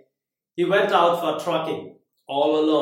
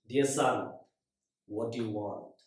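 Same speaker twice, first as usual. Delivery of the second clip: soft voice of God; 5 semitones lower, 9 dB quieter than usual. Retold or imitated.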